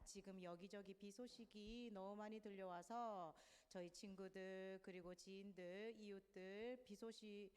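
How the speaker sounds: hushed, even spoken prayer. Real, not acted. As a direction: pray quietly and reverently for everyone's safety. Leads an opening prayer.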